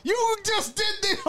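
shout. Real, not acted. Outraged at timeout.

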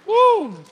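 high exultant woo; joyful whoop. Real, not acted. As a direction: let out a praise whoop. Preacher's joyful shout after climax.

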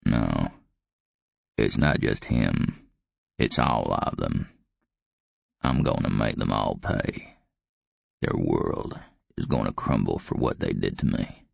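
put-on very low, dry old man's croak; deep, vengeful, contained old growl; dry croaky voice. A narrator's reading. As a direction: croak out the vow, keeping elation hidden. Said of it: "He kept the elation out of his dry, croaky voice".

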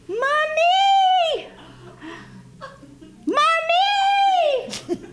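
high, loud, drawn-out scream; blood-curdling cry. Retold or imitated. Frightened young mother clinging to the student, blood-curdling cry. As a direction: cry out desperately at the top of your voice. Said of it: "it was blood curdling".

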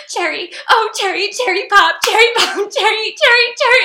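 high, loud, sing-song mimicry of an annoying character; mocking an annoying TV character's chant, mock sing-song; mocking sing-song cherry. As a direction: ham up her grating chant of Cherry. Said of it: "The way she's saying cherry".

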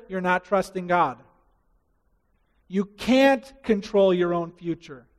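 usual speaking voice

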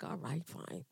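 soft, resigned "all right, fine"; reluctantly giving in to the Lord; grudging surrender. Retold or imitated. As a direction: give in with a sulky sigh. Grudging reply to God.

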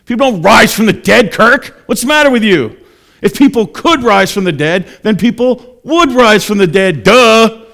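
high, loud mock outrage; incredulous scoffer. Scoffer berating Kirk for believing in resurrection, incredulous shouting. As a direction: shout it as if explaining the obvious to an idiot. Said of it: "I've actually had people say".

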